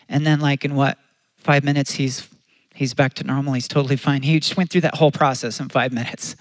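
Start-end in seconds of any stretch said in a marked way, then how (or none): none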